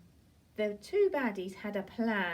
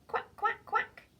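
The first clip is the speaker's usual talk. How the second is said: high, bright quacking; a horse faking a duck; 12 semitones higher, 3 dB louder, slower than usual. Pretend. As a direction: quack like a duck to trick them. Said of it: "so he thinks it's the ducks".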